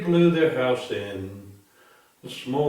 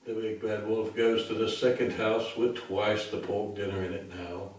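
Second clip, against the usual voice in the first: plain reading voice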